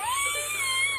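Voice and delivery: high shrieking laugh; burst of laughter. Real, not acted. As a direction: crack up mid-story. Breaks up laughing.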